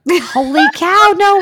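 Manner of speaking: high, drawn-out gasp of disbelief; amazed. Real, not acted. Co-host hears about costume spending, stunned and slow. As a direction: stretch out the surprise, way up high.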